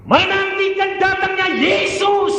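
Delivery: high, forceful preacher's cry; full-force, high-pitched exclamation; shouted proclamation. Real, not acted. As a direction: thunder it out to the congregation. Shouted repeat of the phrase.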